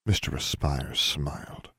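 plain reading voice

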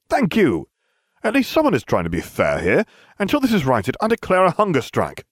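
self-righteous, theatrical outburst; indignant declaration. A narrator's reading. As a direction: proclaim a protest to the hall. Indignant student declares protest.

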